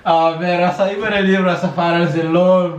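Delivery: normal talking voice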